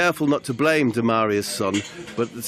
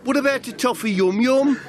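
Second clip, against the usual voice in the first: much higher, bright-toned hostess voice; chirpy Demaria; 13 semitones higher than usual. Acted. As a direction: fuss over him like a cheerful waiter. Plays Demaria, cheery host.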